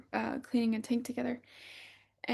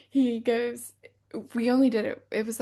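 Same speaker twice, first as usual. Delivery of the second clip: ordinary delivery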